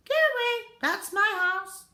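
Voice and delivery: high, loud, indignant cry; high shout. Acted. Tiny Mister Gregg shouts at the ducks, high angry cry. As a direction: yell them away from your house.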